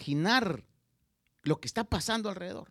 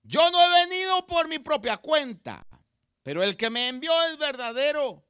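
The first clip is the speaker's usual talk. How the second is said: grita muy alto y lento, con toda la fuerza; proclamándolo a gran voz al templo; grito fuerte; 8 semitones higher, 10 dB louder than usual. Acted. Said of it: "Recuerda, está gritando". (gritando a gran voz)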